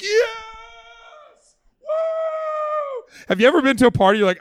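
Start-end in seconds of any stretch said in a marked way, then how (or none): 0.0-3.2 high, drawn-out mock cheer; crowd cheering. Festival crowd as the water hits the ground, high cheering. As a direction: whoop like a crowd at a party. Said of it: "they'd all be like".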